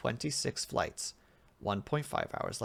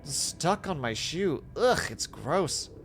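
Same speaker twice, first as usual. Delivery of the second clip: higher-pitched grossed-out complaint; disgusted test subject; 6 semitones higher, 3 dB louder than usual. Acted. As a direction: recoil in disgust at something sticky. Subject finds smelly sticky substance.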